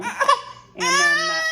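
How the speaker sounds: real tears cut off the words; crying. Real, not acted. Telling her story online, breaking down in tears. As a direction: voice breaks high into a sob, very slow.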